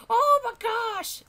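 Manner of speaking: high, loud, slow mock shriek; hammed-up scandalised cry; shocked crowd exclamation. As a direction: imitate everyone squealing in shock. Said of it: "everyone was like".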